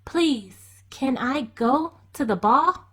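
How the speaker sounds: put-on girl begging to go; pleading Cinderella. A narrator's reading. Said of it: "asked Cinderella".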